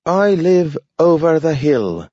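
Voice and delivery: high voice with a strange unplaceable lilt; careful like a learner of the language; talking dog, odd intonation. A narrator's reading. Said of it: "a certain eccentricity of intonation".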